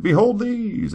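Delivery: high, loud proclamation; booming God voice. Acted. Servant hears a grand lord, high and loud.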